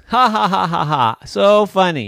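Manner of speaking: sarcastic laugh. Acted. Ghost fed up with boo jokes, sarcastic laughter. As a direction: fake a laugh, then scoff.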